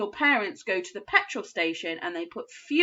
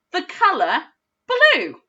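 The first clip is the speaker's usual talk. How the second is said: "blue" sung out high and louder; bright confirmation; 9 semitones higher, 6 dB louder, slower than usual. Shown as a demonstration. Teacher cheering the correct word for pupils. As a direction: announce the answer with delight. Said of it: "I think it's the first one".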